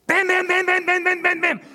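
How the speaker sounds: loud, high mock knocking; door pounding. Husband imitating banging on the front door, loud. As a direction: bang out each bam like fists on a door.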